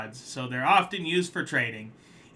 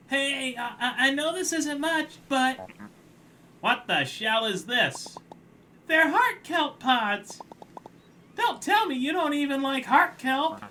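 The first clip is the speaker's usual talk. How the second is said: higher, anxious little crab; nervous crab; 12 semitones higher, 3 dB louder than usual. Acted. Crab defending his kelp payment, high anxious voice. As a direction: plead up high and unsure.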